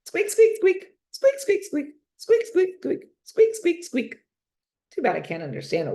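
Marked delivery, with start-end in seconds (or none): 0.0-4.1 high, loud squeaking like a little hamster; excited hamster squeak. A narrator's reading. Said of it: "He even reads it out loud".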